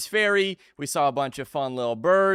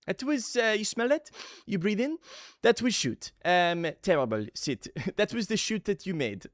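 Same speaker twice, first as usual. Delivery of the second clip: imitated French clown teacher, higher and halting; French accent; 4 semitones higher than usual. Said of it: "but they'd be like".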